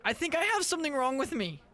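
put-on a kid confessing a scary secret; middle school voice. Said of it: "That's my middle school voice".

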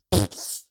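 quick burst of mouth noise; imitated sucked-back fart. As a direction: mimic a fart being pulled back in. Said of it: "He went".

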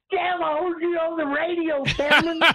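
high, fast, shouted hometown excitement; excited shout. Retold or imitated. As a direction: yell it like the whole town's thrilled. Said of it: "Everybody in town called me".

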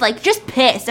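ordinary delivery

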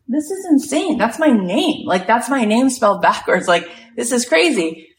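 high-pitched, stunned retelling; incredulous, voice lifted high; amazed disbelief. Retold or imitated. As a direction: act out being floored by a coincidence. Retells actress stunned at coincidence.

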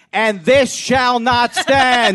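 hammed-up grand oratory; mock pompous decree. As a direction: declare it like a dictator at a podium. Mock Khrushchev speech.

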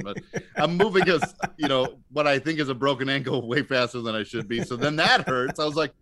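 usual speaking voice

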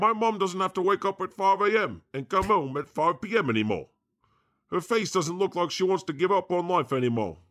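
a clumsy attempt at a Cockney accent; bad Cockney. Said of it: "I need to work on my accents. That was that Cockney".

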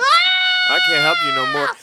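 high, screechy cry of defeat; overwhelmed hairbrush. Acted. Hairbrush giving up on mama, high drawn-out wail. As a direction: shout it way up high, stretched out. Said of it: "It said".

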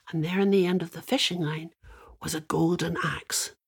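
ordinary delivery

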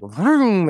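a loud, high vroom sound effect; mock engine vroom. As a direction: burst out with a revving vroom. Energy bursting like blender.

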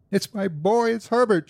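high-pitched, desperate cry; frantic cry. A narrator's reading. Mother insists her boy is at the door, frantic cry. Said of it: "she cried".

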